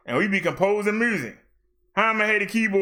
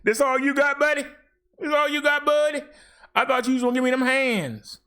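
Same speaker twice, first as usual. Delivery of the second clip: higher, goading mock taunt; taunting heckler; 6 semitones higher than usual. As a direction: needle him like a heckler calling out a wrestler. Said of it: "Somebody should get up and say".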